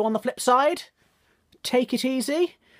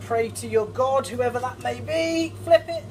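dramatic build; 6 semitones higher than usual. Real, not acted. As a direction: build tension, then shout the flip. Dramatic flip of the pan.

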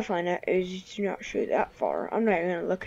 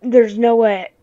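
much louder indignant shout; outraged disbelief; 10 dB louder than usual. Real, not acted. Gamer shot again, protesting in a loud shout. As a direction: yell it in disbelief at the game. Angry disbelief at being killed.

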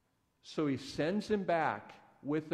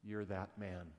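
quiet accusation; 5 semitones lower, 8 dB quieter than usual. Retold or imitated. Prophet confronts David with his sin.

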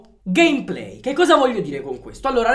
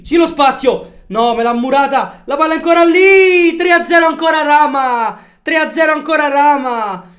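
shouted, stretched play-by-play; breathless italian goal commentary; 5 semitones higher, 8 dB louder than usual. Real, not acted. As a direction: call the goal like a live commentator.

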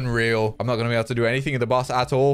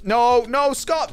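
higher, louder panicked no; alarmed cry; 10 semitones higher, 4 dB louder, much slower than usual. Real, not acted. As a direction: call out as your teammate goes down. Teammate dying in the dungeon.

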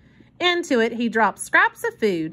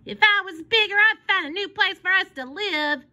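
put-on boasting boy; 9 semitones higher than usual. A narrator's reading. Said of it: "he boasted".

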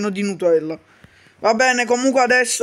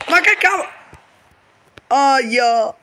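startled; slower than usual. Acted. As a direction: caught off guard, voice jumps up. Character startled by grenade.

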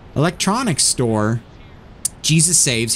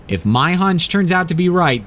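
usual speaking voice

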